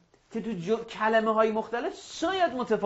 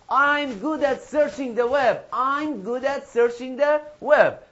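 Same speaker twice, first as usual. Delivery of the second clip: slow, loud, raised-pitch classroom chant; emphatic drill model; 4 semitones higher, 5 dB louder, much slower than usual. Shown as a demonstration. Teacher drills web phrases with pupils, emphatic slow model. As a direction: punch out each phrase slowly to be copied.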